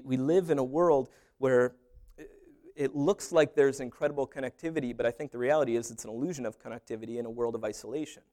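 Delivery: normal talking voice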